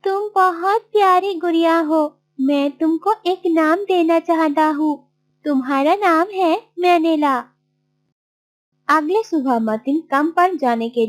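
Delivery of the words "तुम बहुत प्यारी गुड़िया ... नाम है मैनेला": higher-pitched character voice for Martin; young hero Martin, affectionate. Acted. Martin naming his new doll, affectionate.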